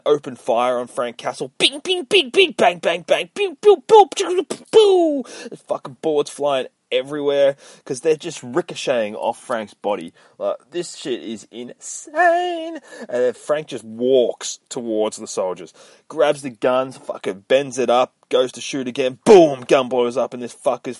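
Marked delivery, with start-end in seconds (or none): 1.6-5.2 loud, high rapid-fire bings and pews; spray out cartoon bullet sounds, pitched way up; imitated gunfire. Retelling soldiers opening fire, loud gunfire noises. 19.2-19.6 big, loud, high boom; imitated explosion. Retelling a gun blowing up, explosive boom.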